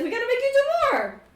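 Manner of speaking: very high, rapid, loud exclamation; emphatic repeat. Acted. Character repeats himself after a baffled "What?", exclaiming.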